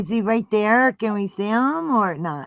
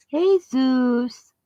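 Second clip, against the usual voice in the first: squeaky praising a dog; 4 semitones higher than usual. Real, not acted.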